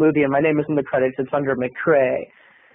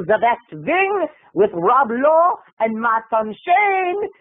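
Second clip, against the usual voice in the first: higher-pitched mock German diva; bad German accent; 6 semitones higher than usual. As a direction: fumble into a badly faked German accent. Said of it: "it's not very good German".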